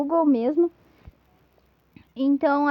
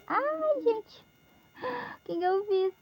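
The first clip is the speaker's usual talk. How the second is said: startled dismay; 8 semitones higher, 5 dB quieter than usual. Real, not acted. Pen trouble, mishap.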